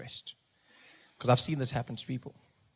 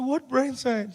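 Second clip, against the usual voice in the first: incredulous; 10 semitones higher, much slower than usual. Retold or imitated. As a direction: fire it back in disbelief. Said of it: "I said".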